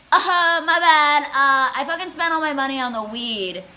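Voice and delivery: hammed-up dopey excuse, high and loud; mocked date. Broke date confessing to her at the ticket taker, mocking imitation.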